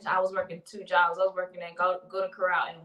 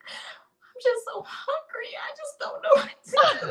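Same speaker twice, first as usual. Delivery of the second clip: high, mock-tearful whine breaking into laughter; emotional crying. Pregnant worker breaking down to coworker, mock crying. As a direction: act out a hungry, weepy meltdown. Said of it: "I just started getting emotional".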